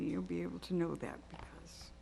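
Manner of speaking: near-whispered, choked with feeling; words dropping to a breathless hush; emotional whisper. Real, not acted. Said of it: "it's emotional for me".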